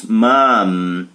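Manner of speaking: "Mom" ending with closed lips on the M. Shown as a demonstration. Teacher telling students to close their lips on "Mom". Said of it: "fecha os lábios".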